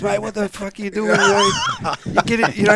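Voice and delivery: half-acted blast of a furious opponent; retold angry outburst. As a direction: mates retelling a footy spat, imitating the angry player. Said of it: "Kras cracks his shits at me".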